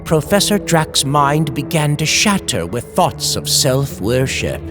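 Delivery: usual speaking voice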